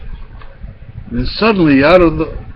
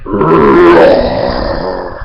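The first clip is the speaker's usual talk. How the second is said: low, loud rolling imitated roar; lion roar; 8 semitones lower, 5 dB louder than usual. A narrator's reading. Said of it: "a roar in the distance".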